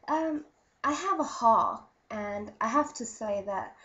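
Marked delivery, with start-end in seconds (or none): none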